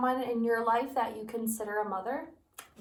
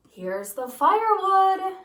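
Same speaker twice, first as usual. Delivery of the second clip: high, loud and slow call; shouting Jack; 8 semitones higher, 9 dB louder, slower than usual. Acted. Boy shouting to his mother with the firewood, slow loud call. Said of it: "shouted Jack".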